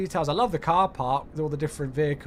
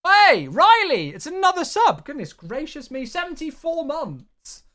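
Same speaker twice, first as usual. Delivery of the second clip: excited shoutout; 10 semitones higher, 8 dB louder than usual. Real, not acted. Streamer thanks Riley for resubscribing, loud excited shoutout. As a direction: call out the long-time sub in amazement.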